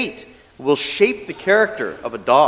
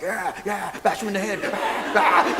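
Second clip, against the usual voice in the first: dog voice; 4 semitones higher than usual. Pretend. Dog voiced copying owner.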